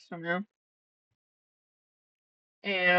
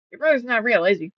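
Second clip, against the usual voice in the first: pointed accusation; 4 semitones higher, 6 dB louder, faster than usual. Retold or imitated. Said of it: "I'm like".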